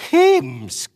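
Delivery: mock outraged outburst; exclaiming. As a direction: blurt it out in horror. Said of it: "utbrast Tova".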